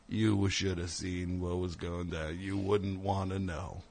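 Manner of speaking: put-on soft, slow spooky old-timer; hint darkly at what went on; mock ominous. Comic mimics park employee's ominous warning, hushed.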